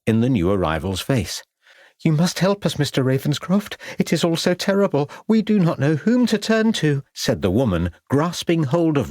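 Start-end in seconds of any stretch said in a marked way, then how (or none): 2.0-7.0 high, breathless plea from a frightened wife; pleading lady. A narrator's reading. Distraught wife begs the detective to help, pitch rising. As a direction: let her voice climb as she begs.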